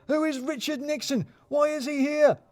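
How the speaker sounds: high, startled outburst from a boss; startled shout. Acted.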